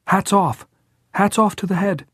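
put-on high, loud boyish exclamation; urgent, high-pitched rallying cry; frantic cry. A narrator's reading. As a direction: call it out with frantic excitement. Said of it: "frantic with understanding".

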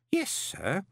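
high, patient humouring; as to a child. Acted. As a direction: humour him like a small child. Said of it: "as if talking to a child".

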